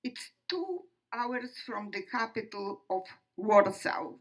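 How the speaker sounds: ordinary talk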